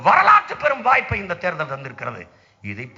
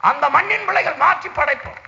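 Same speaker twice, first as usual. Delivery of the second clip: usual speaking voice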